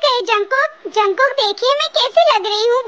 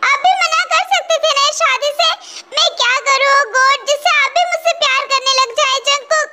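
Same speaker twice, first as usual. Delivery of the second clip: crying; 6 semitones higher than usual. Acted. Wife alone, crying over husband.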